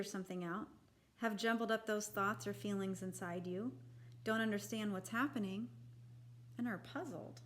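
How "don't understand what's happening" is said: usual speaking voice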